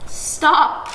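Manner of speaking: ordinary delivery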